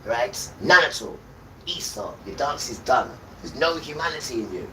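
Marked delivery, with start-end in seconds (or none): 0.6-1.2 louder, emphatic angry denial; angry shout. Real, not acted. Scolding the firm about humanity, loud angry denial. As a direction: hammer it home loudly.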